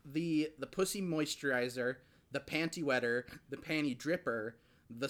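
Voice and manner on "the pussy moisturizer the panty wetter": ordinary delivery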